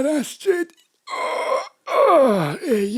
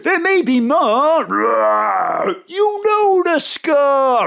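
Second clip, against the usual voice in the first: drawn-out, slow lion voice; lightly voiced lion; 5 semitones lower, much slower than usual. [name] as a giant lion urges more beer, slow.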